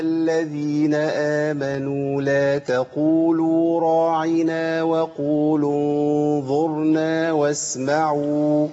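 تلاوة بطيئة ممدودة بنغم; رتّل الآية على مهل كالمعلم; تلاوة مجودة. Shown as a demonstration. Teacher recites verse. (تلاوة مرتلة للطلاب)

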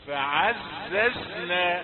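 فعززنا said very slowly with a hissing z; correct sibilant zay. Shown as a demonstration. Teacher showing zay not dhal. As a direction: stretch فعززنا, keep the z whistling. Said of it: "فلا تنطق الزاي ذال".

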